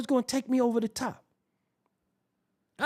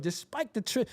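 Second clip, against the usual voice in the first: normal talking voice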